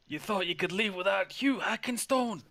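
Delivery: eager little dwarf hollering to catch up; Hugh Hackenstone's voice. Acted. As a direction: shout after them, don't leave me behind. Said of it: "you hear this voice, this familiar voice".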